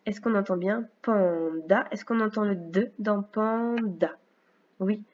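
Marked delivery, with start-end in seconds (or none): 1.0-1.9 drawn-out "panda" in two clear pieces; syllable by syllable. Shown as a demonstration. Teacher asking if the sound is heard, syllable by syllable. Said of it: "dans la deuxième syllabe". 3.2-4.2 "panda" slowly split into "pan" and "da"; syllable by syllable. Shown as a demonstration. Teacher checking for a sound with a pupil, syllable by syllable. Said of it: "dans la deuxième syllabe".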